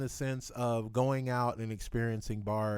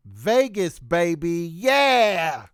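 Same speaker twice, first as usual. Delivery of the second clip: hyped up; 6 semitones higher, 12 dB louder, much slower than usual. Acted. Hyped exclamation about Vegas.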